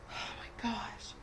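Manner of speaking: slow whispered oh my gosh; stunned whisper. Real, not acted. Veteran ranting about vandalized memorials, slow stunned whisper. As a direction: breathe it out as a whisper, lost for words. Overwhelmed pause mid-rant.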